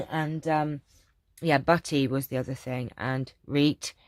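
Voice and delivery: ordinary talk, nothing marked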